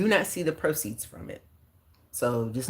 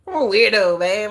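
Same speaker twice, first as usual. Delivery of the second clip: laughing; 6 semitones higher, 9 dB louder than usual. Real, not acted. Proud self-labeling with laughter.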